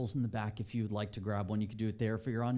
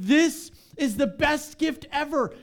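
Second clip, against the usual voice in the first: slow, high and louder; overjoyed; 13 semitones higher, 8 dB louder, slower than usual. Retold or imitated. To Eileen with a grin from ear to ear, overjoyed. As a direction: savour it with a huge grin. Grinning at Eileen over coffee.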